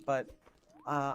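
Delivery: ordinary delivery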